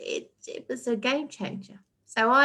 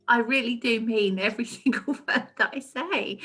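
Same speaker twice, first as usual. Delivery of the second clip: laughing sincerity. Real, not acted. Speaker assuring she means her words, laughing.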